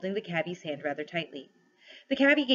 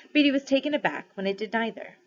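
ordinary delivery

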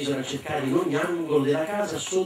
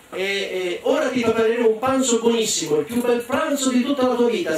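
put-on higher, louder eager children; shrieked; 7 semitones higher, 3 dB louder than usual. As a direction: squeal it with delight. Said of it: "strillarono tutti i fratelli".

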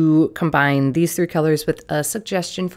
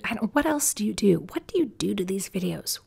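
whispering; 3 semitones higher, 6 dB quieter, faster than usual. Pretend. Joking existential muttering.

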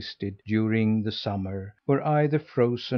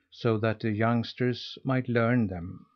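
usual speaking voice

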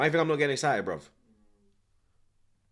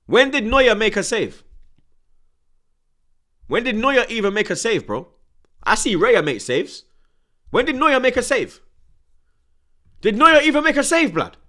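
much higher and louder, building to a yell; furious shouting; 10 semitones higher, 9 dB louder than usual. Real, not acted. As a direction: scream it again and again, climbing each time. Fan raging about keeper.

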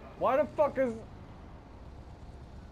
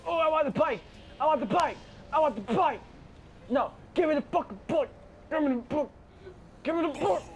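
whining demand; 8 semitones higher than usual. Real, not acted.

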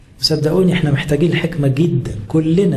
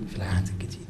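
في العهد الجديد repeated much lower and softer; hushed echo; 8 semitones lower, 12 dB quieter, faster than usual. Shown as a demonstration. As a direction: echo the question back almost under your breath. Rhetorical question, then quiet echo.

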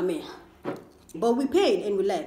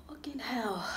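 whispered curse; 11 dB quieter than usual. Real, not acted. Mouth burning from the spice.

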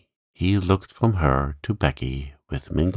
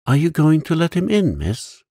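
put-on eager servant girl; 10 semitones higher, 5 dB louder than usual. A narrator's reading. Becky, servant girl, eager question.

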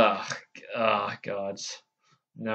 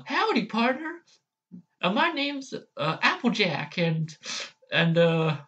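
a hammed-up southern howdy, pitched up; Applejack impression; 7 semitones higher than usual. Vlogger trying Applejack for viewers, high country twang. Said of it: "let's move on to A J here".

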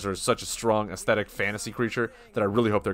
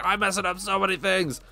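flustered player; 8 semitones higher than usual. Retold or imitated. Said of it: "I'll be like".

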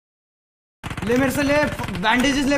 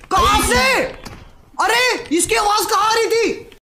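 urgent shouting; 7 semitones higher, slower than usual. Real, not acted. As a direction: shout to find where the shots came from. Tense firefight, locating enemy. (तनाव में ज़ोर से चिल्लाते हुए, धीमे और ऊँचे)

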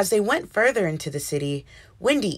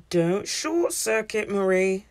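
teacher voice; slower than usual. A narrator's reading. Teacher voices a calming warning.

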